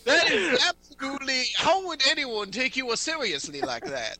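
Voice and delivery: high, loud character scolding; affronted scolding. Acted. Character asking how anyone takes you seriously, affronted scolding.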